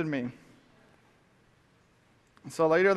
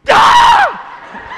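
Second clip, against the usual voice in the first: very high, loud laughing yell; high shout breaking into laughter; angry yell; 13 dB louder than usual. Retold or imitated. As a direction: let out a wild frustrated scream. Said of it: "I was just so mad".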